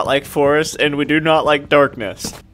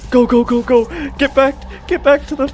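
high loud frantic commands; frantic shouted go go go; urgent shouting; 10 semitones higher, 3 dB louder, faster than usual. Real, not acted. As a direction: yell at yourself to run. Fleeing in panic.

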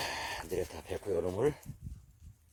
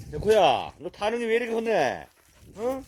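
loud, high telling-off of a dog; scolding call; 8 semitones higher, 4 dB louder than usual. Real, not acted. Owner scolding the dog that bullies another, loud and high.

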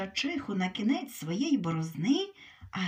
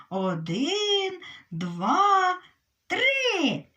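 counting shout; 7 semitones higher, 4 dB louder, much slower than usual. Acted. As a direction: count rising high and louder. Hare counts off second race. (рахунок, що наростає до вигуку)

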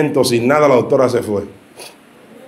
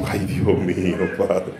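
stunned gasp; 8 semitones lower, 9 dB quieter, much slower than usual. Retold or imitated. Shock at the hospital bill.

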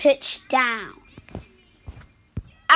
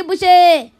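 normal talking voice

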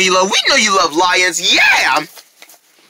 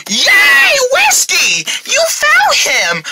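excited cheer; much slower than usual. Acted. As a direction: squeal with joy.

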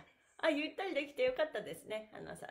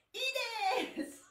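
high, slow, sung-out いいです; pose call; 10 semitones higher, 3 dB louder, much slower than usual. Shown as a demonstration. Instructor leading the pose, calls いいです high and drawn out. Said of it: "いいですのポーズいきますよ".

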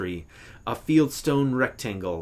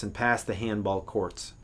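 ordinary delivery

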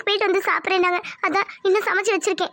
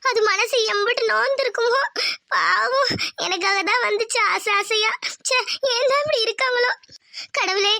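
tearful lament. Acted. Daughter weeping alone over her humiliated mother, tearful lament. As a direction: lament through small sobs.

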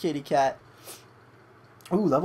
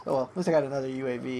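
ordinary talk

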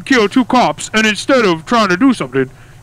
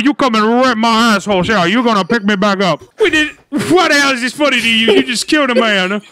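usual speaking voice

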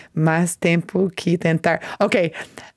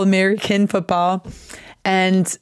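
usual speaking voice

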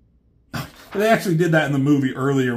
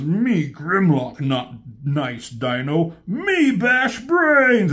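slow, blunt brute voice, ending higher and louder; a hint of a dumb stubborn dinosaur; lightly voiced Grimlock; 4 semitones higher, 3 dB louder, much slower than usual. Grimlock refusing Blur, slow blunt brute voice. Grimlock speaking caveman-style.

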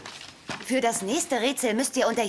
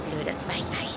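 muttered insult; 10 semitones lower, 8 dB quieter, slower than usual. Acted.

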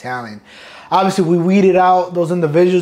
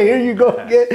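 high, loud mock mom scolding through laughter; upset mom; 9 semitones higher, 4 dB louder than usual. Mom scolds son for deploying again, high mock complaint. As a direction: play the betrayed mother, laughing as you do it. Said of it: "And I'm like".

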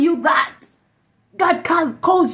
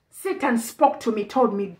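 normal talking voice

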